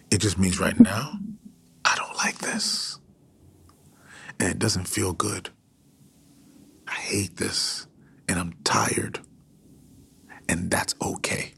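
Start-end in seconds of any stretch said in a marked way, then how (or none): 1.9-11.5 barely voiced, tired and hurting; breathy whisper of exhaustion; hushed weary confession. Voicing weariness and pain.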